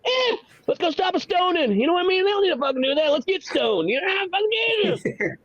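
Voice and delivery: high-pitched rowdy stoner buddy; high, hollered party-guy voice; party-dude Jesus. Acted. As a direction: egg your friends on in a high, excited voice. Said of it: "hanging out with Jesus".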